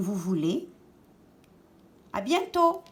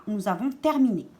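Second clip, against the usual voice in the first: normal talking voice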